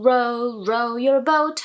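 "row, row your boat" run quickly with the English R. Shown as a demonstration. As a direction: link it up quickly. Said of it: "조금 더 빨리 해주시면".

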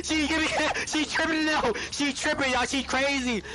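ordinary delivery

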